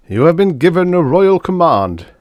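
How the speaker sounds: high, loud nasal-toned court flunky; a pompous footman delivering a summons; adenoidal courtier. A narrator's reading. Court flunky announcing the queen's summons, high and loud. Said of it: "an anecdotal voice said in my ear".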